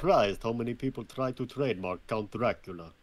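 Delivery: put-on vampire count voice, Dracula accent. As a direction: speak as a stagey Dracula.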